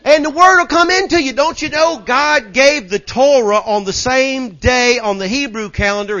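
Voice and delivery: high, loud pulpit delivery; full-force preaching. Real, not acted. Preacher telling church about Spirit and word, full-force preaching. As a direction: drive each phrase home to a roused congregation.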